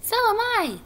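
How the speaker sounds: extremely high, squeaky little pipe; third tiny voice. A narrator's reading. Third little spider chimes in, highest tiny voice. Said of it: "said a third voice".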